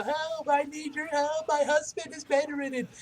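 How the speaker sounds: put-on high, slow, pleading old woman; crying old woman. Old woman begging heroes for help, high tearful plea. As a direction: beg for help for her bedridden husband. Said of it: "there crying her eyes out".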